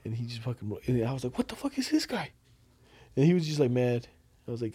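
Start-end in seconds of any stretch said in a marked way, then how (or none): 1.4-2.3 high, soft incredulous question; retold bafflement. Recalling the angry man to a friend, retold bafflement. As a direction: re-enact private disbelief at a stranger. Retells own baffled reaction.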